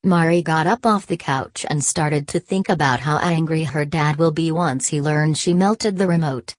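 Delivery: usual speaking voice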